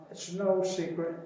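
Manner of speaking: usual speaking voice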